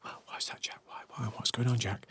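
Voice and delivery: very soft, whispered questions; mock-reverent audience whisper; awed whisper. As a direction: whisper eagerly like a hushed disciple. Audience whispering eagerly to the guru.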